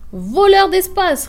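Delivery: high, loud, slow shouted insult; angry retort. A narrator's reading. Grisou shouts back at Brun in the fight. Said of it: "répliquait Monsieur Grisou".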